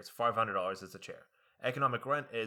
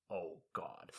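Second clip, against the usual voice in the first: quiet groan; 6 semitones lower, 10 dB quieter than usual. Retold or imitated. Class groaning in their heads.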